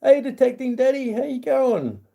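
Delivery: high bright hello, pitched well up; upbeat high call of hey; cheery viewer greeting. Real, not acted. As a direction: sing out a welcome to a new viewer.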